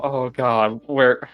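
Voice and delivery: ordinary talk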